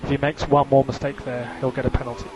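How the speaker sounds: ordinary talk, nothing marked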